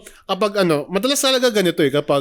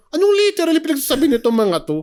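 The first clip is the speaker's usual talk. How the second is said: annoyed mock voice; 10 semitones higher than usual. Mocking annoyed inner voice.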